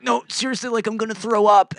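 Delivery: high, loud panicky pleading; a mock frantic warning from the back seat; panicked plea. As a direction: beg urgently like you're about to be sick. Panicked friend about to vomit.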